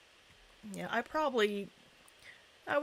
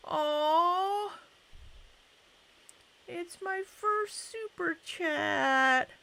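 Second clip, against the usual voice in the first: high, drawn-out aww of gratitude; really moved and thankful, high voice; touched delight; 8 semitones higher, slower than usual. Real, not acted. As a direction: gush sweetly, pitched well up. Touched by a first super chat.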